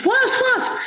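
high, startled double what; a sudden panicked squeak of a question; alarmed what. Retold or imitated. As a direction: jump in alarm at the news. Startled girl hears brother's news.

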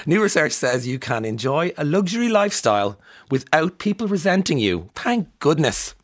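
high, loud and giddy; bubbling over after her morning run; wild giddy. Real, not acted. Host feeling wild after a run, giddy and high. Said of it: "I feel a bit wild this morning".

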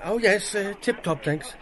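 high, loud breezy answer; hearty brisk reply. Acted. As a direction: toss it off cheerfully, voice up high. Bloom's hearty reply to McCoy.

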